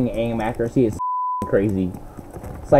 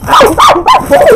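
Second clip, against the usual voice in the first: startled yelp; 8 dB louder than usual. Real, not acted. Suddenly seeing many enemies.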